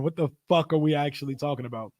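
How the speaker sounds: usual speaking voice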